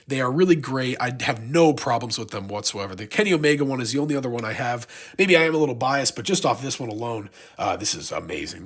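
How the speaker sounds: ordinary talk, nothing marked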